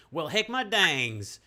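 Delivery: put-on loud, slow New Mexican drawl of an old cowboy; New Mexican accent. Reading Nash's line aloud to a friend in New Mexican accent. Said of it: "that's my New Mexican accent".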